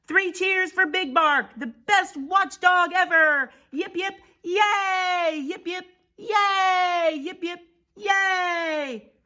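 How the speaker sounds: high, loud, stretched hurrahs; jubilant cheering. Acted. Prairie dogs giving three cheers for Big Bark, jubilant. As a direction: lead a crowd in cheers.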